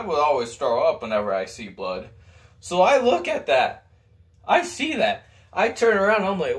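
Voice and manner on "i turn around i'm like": ordinary talk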